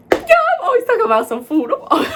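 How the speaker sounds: high, loud laughing admission; real laugh breaking into the words. Real, not acted. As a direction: crack up at yourself while talking. Laughs at own love of food.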